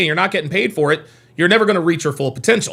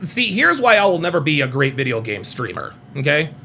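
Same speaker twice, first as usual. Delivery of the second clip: usual speaking voice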